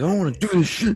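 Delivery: teasing a friend with a high, loud mock-kid voice; mock tantrum. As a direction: squeal it like a kid throwing a fit. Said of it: "Jared's like".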